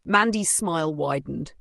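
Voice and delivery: ordinary delivery, nothing marked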